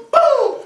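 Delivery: loud, high "boom"; shouted impact noise. Acted. Smacking the roach mid-sentence, explosive shout. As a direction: explode on one syllable.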